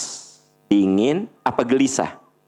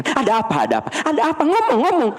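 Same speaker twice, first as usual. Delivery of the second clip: frantic mother; much faster than usual. Retold or imitated. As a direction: demand it like a panicked mother. (ibu panik mendesak anaknya bicara)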